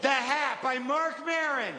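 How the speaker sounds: put-on high, slow childlike announcement; child reading a title. Comic presenting his imagined children's book, mock child voice. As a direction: announce the storybook proudly in a put-on little-kid voice.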